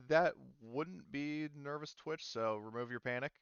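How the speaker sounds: ordinary talk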